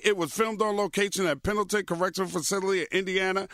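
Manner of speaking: smooth sponsor ad read. Acted. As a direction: read it like a host ad.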